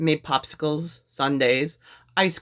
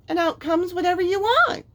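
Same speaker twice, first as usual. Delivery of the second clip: much higher, keen; eager boy; 13 semitones higher than usual. A narrator's reading. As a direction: finish with boyish glee.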